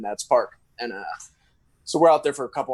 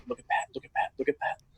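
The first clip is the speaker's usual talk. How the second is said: urgent softer whisper; 8 dB quieter, faster than usual. Retold or imitated. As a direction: point it out secretly. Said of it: "He's like".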